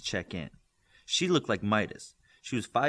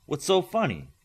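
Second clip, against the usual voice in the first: serious, higher and louder question; serious face; 8 semitones higher, 5 dB louder than usual. Acted. Olympian to Midas after his laugh, serious and louder. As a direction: ask it dead seriously, pitched up. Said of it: "with a serious face".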